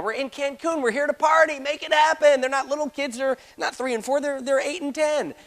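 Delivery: high, pushy party-mood plea; high and eager, slowing at the end; excited pleading. Retold or imitated. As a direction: talk the manager into it, all pumped up. Pleading with hesitant manager.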